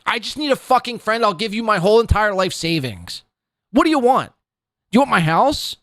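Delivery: high whiny begging impression of Aaron; mock Aaron. Mocking Aaron begging people to be his friend, high and whiny. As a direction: plead like a lonely desperate man.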